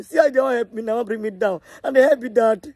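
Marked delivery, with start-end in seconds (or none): none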